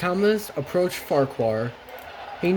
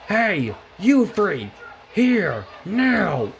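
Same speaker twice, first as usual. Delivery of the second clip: higher, drawn-out angry call; screamed order; 5 semitones higher, much slower than usual. A narrator's reading. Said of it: "saw the kids on the platform and screamed".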